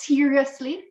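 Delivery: exasperated retold complaint, starting high; annoyed with God. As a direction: rant at God in disbelief, emphatic then settling. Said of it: "I was annoyed with God".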